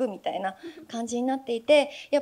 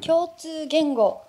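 共通言語 drawn out slowly, each syllable given weight; slow reveal; 5 semitones higher, 4 dB louder, much slower than usual. Real, not acted. Revealing her card answer to the audience, slow and deliberate.

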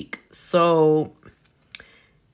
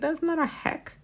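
scoffing; 11 semitones higher, 7 dB quieter than usual. Retold or imitated. Scoffing at a video's hack.

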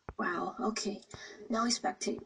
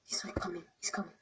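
whispering; 4 semitones lower, 8 dB quieter, much faster than usual. Real, not acted. Seeker approaching while hiding.

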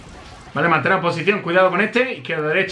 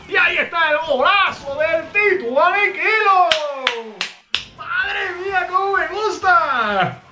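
breakneck goal commentary; 11 semitones higher than usual. Real, not acted. Celebrating a goal.

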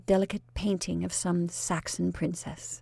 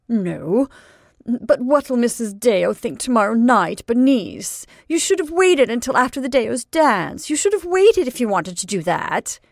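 loud reproach; scolding aunt; 4 semitones higher, 6 dB louder than usual. Acted. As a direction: scold her for not waiting.

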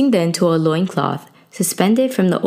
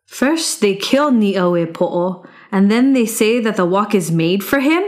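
put-on young hero scoffing; 3 semitones higher than usual. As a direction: throw their words back at them. Youth scorns the guards' answer.

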